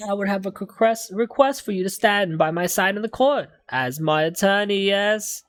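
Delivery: eccentric game defendant. Acted. Client haggling with two students over payment, pompous and high. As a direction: put on an affected London accent. Voices defendant asking for attorney.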